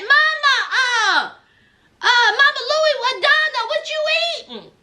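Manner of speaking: mock outraged shout. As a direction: shriek at the mom in comic disbelief. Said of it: "I said".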